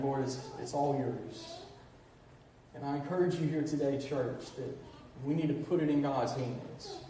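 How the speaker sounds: soft, low, hushed preaching; quiet prayerful appeal. Acted. Pastor gently leading the church into surrender, hushed and low.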